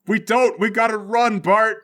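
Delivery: loud, high urging to run; urgent shout. Acted. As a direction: shout at a teammate to flee. Urging Bart to flee.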